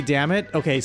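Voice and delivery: normal talking voice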